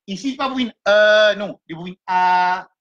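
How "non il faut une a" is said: normal talking voice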